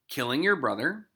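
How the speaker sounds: normal talking voice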